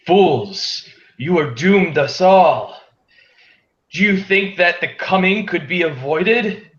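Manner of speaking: a vampire scolding the party from above, loud and high; booming vampire. Acted. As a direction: boom down from the sky like a scornful vampire lord. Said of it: "in a voice much louder than one would expect".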